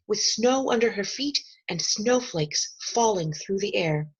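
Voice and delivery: plain reading voice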